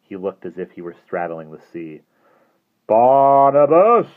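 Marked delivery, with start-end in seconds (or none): 2.9-4.1 high, loud, slow drawn-out call of a name. Acted. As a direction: stretch the name out, summoning. Hastings calls the boy.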